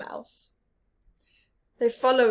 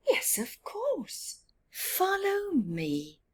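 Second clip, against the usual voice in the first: badger voice; 9 semitones higher, slower than usual.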